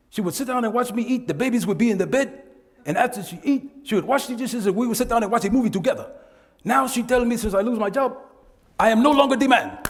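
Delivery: quoting a jobless client. Retold or imitated. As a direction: play the hurt husband complaining. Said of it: "this one guy said".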